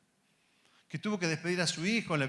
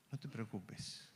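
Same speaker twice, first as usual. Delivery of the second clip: much softer, almost hushed, "no te preocupes"; soft reassurance; 7 semitones lower, 16 dB quieter, slower than usual. Acted. God quieting the anxious Ernesto, very softly. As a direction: hush him gently.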